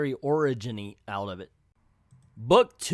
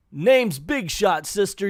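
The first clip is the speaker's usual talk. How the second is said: loud, higher and slow on the name; retold yell; 6 semitones higher, 5 dB louder, slower than usual. Big Shot warning the woman off, retold loud yell. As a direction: bark the name out as a tough guy.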